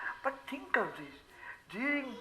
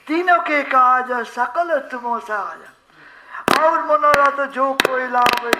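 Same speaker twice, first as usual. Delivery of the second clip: steady sustained chant, growing louder; devotional verse recitation; 5 dB louder than usual. Real, not acted. Preacher quotes Tulsidas to devotees, chanted recitation. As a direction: intone the couplet on an even note.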